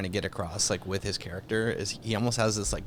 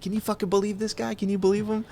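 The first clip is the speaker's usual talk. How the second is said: much higher mock disbelief; incredulous Pesci; 12 semitones higher than usual. Gangster complaining to his buddy, mock disbelief. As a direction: turn to your buddy, aghast. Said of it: "He's like".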